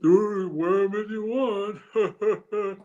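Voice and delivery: slow, high, loud dopey cop voice; dumb cop. Retold or imitated. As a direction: drawl it out like a clueless cop. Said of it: "his dumb cop voice".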